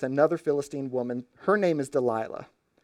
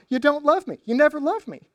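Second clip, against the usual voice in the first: high, loud mock-hurt whine; mock sobbing guilt trip in a high voice; hammed-up wounded lover; 12 semitones higher, 6 dB louder than usual. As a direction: overact the betrayed girlfriend. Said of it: "puts on another Oscar-winning performance".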